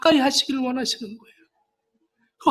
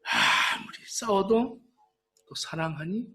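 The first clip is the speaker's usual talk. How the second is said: slow and soft, almost murmured; slow, soft lover's question; 4 semitones lower, 6 dB quieter, slower than usual. Acted. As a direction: linger over each word tenderly. Lover's question voiced softly.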